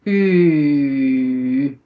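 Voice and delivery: "U" sustained low as Dutch uu; long rounded vowel. Shown as a demonstration. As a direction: hold "U" long with puckered lips. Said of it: "Put your lips like this".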